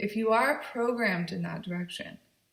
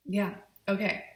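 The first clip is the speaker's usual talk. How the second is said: ordinary delivery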